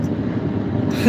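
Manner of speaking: high, breathless laughing fit; real laughter. Real, not acted. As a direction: crack up at her own word, half crying. Laughing at her own word.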